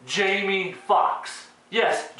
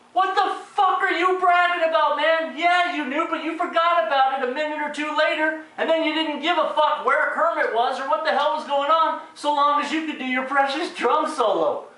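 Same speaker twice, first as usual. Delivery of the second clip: high-pitched exasperated tirade; outraged rant; 9 semitones higher than usual. Real, not acted. Critic berating Animal for bragging, high outraged rant. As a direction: tear into him, voice pitched way up.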